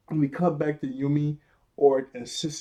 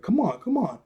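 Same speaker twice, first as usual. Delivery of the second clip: higher, swooping urging; mock urgent pleading; 8 semitones higher than usual. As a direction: act out coaxing a stuck door. Acted urging at stuck door.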